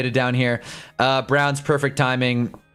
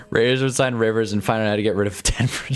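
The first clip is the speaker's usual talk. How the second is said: ordinary delivery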